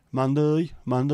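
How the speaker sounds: lightly put-on Brummy lilt; Brummy accent. Real, not acted. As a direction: slip briefly into a Brummy accent. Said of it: "I said it in a Brummy accent".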